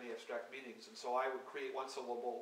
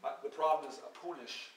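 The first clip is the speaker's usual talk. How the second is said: reading poem words; 2 semitones higher, 3 dB louder than usual. Acted. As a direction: let each word hang alone. Reads invented poem words aloud.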